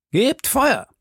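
high-pitched command to fire; calling out. A narrator's reading. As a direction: give the order high, without full volume. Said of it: "rief Athos". (hoch gerufener Feuerbefehl)

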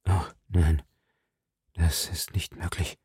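low, hushed, voice sinking away; horrified near-murmur; stunned disbelief. A narrator's reading. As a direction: barely get it out, soft and low. Athos horrified by terrible suspicion.

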